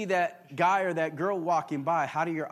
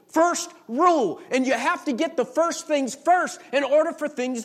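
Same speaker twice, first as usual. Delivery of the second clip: higher and louder, hammering each principle home; full-force preaching; 9 semitones higher, 9 dB louder than usual. Acted. Pastor exhorting the congregation on God's principles, preaching hard. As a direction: build the sermon to its peak.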